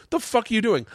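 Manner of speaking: high-pitched retold challenge; indignant challenge. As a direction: challenge him in mock indignation. Retold challenge to man approaching stage.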